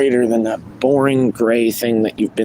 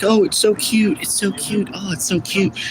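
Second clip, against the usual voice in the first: high gushing "so cute" mimicry; mock a woman cooing over a dog; mocking gushy woman; 8 semitones higher than usual. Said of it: "they're like".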